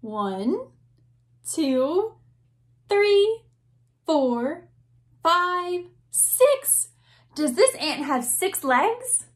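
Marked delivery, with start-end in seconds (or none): 0.0-6.9 counting up, louder and higher; rising excited count. Shown as a demonstration. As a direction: build the count to a big finish. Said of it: "count nice and loud".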